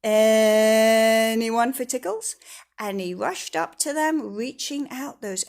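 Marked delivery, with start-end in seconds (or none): 0.0-2.3 loud, slow, drawn-out teasing call; gleeful cry. Acted. Mister Tickle spies his victims, loud and gleeful. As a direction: call out, relishing the mischief. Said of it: "cried Mister Tickle".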